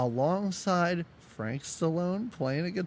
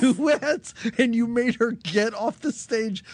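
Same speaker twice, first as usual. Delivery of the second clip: incredulous protest; 7 semitones higher, 4 dB louder than usual. Acted. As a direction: call him out in disbelief, high and emphatic. Mock outrage at film character.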